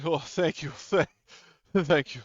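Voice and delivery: high, loud frightened pleading; panicked survivor. Acted. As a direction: stammer urgent thanks in a panic. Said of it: "And, like, panicking and stuff".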